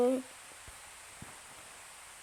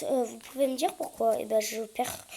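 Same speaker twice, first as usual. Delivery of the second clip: usual speaking voice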